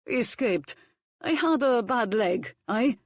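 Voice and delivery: a hint of a gruff foreign cook; bossy kitchen instructions, lightly accented. A narrator's reading. Hercules instructing Violet at potatoes, lightly accented. Cook's tale.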